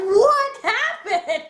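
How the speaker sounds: high, slow, laughing what happened; hammed-up shock at the news; stunned disbelief. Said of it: "it's like".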